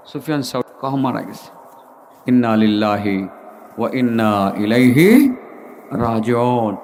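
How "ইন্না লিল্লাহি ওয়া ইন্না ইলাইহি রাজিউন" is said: low, drawn-out Arabic recitation; low chanted condolence formula; solemn recitation. Acted. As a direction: recite the words gravely, stretching the vowels. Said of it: "তিনি পড়লেন".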